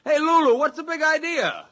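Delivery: loud, high outraged shout; angry shout. Acted. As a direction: yell up at her in protest. Crew member yells at Lulu.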